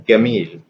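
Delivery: gamil with a hard g for jim; Egyptian hard g. Shown as a demonstration. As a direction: say gamil with g as in "go", not j. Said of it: "So jim becomes ge".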